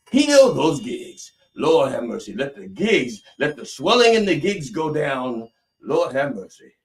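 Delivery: loud, high revival preacher cry; mock faith healer. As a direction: cry out a hammed-up healing, stretching the first words. Mock faith healer at full force.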